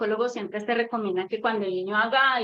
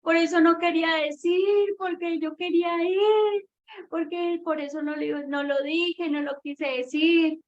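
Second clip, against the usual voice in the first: imitated tantrum wail; 6 semitones higher than usual. Mother imitating her son left behind, wailing in protest. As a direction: wail like a protesting child, words stretched. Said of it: "empieza a gritar".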